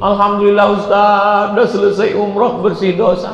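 high mock-weeping pilgrim; hammed-up high crying relief; imitated tearful thanks. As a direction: thank the ustaz in a put-on tearful voice. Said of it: "orang menangis".

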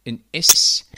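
"s" as a loud, sharp voiceless hiss; Amsterdam hard S. Shown as a demonstration. Teacher showing what Amsterdammers say, loud hard S. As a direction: hit the "s" hard and unvoiced. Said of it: "En Amsterdammers zeggen".

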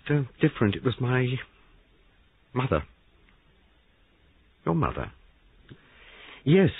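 a hint of a nervous young man. A narrator's reading. Young man confessing, higher voice.

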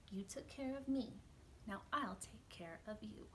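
gentle storybook read-aloud with soft hushed refrains; reading to children. A narrator's reading. Librarian reading a picture book to kids, gentle storytelling.

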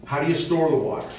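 usual speaking voice

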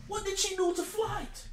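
very soft, high-pitched plea; hushed mock concern. Reactor puzzling over Flight's grudge, hushed mock concern. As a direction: ask it in baffled, tiny-voiced concern.

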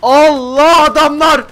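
loud, high, drawn-out cry of alarm; alarmed shout. Real, not acted. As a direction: shout out as enemies suddenly appear. Spots enemies suddenly in game. (panikle bağırarak)

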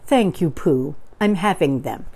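usual speaking voice

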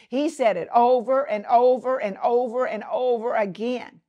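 ordinary delivery

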